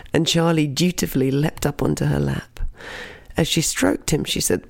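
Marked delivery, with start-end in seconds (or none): none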